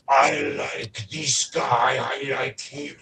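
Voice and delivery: put-on very slow, drawn-out Russian accent. Wife after a big bear hug, imitated Russian accent. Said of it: "I'm from former Soviet Union".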